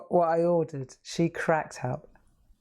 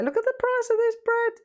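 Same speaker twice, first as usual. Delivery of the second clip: mock outrage. Real, not acted. Shocked at bread price.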